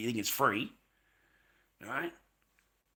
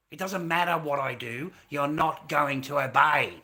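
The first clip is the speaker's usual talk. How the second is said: loud, raw yelling rant; real fury at the system, shouted; furious shouting; 3 semitones higher, 11 dB louder than usual. Real, not acted. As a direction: let the anger boil over and shout it.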